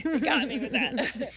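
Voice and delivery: laughing. Real, not acted. Laughing at shock.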